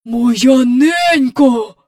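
гучно й дуже повільно, приголомшено; протягни з подивом. A narrator's reading. Приголомшений доглядач. (доглядач ошелешено вигукує)

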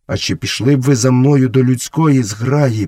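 plain reading voice

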